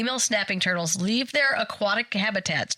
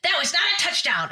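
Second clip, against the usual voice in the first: angry parents; 10 semitones higher than usual. Retold or imitated. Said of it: "They're like".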